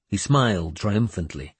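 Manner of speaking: ordinary delivery, nothing marked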